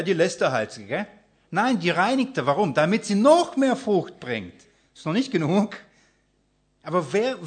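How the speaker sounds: loud, fired-up preaching, calling it out to the room; preaching at full force. Acted. Preacher rousing the congregation, loud and fired up. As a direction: drive the point home to the congregation. Builds up the fruit point.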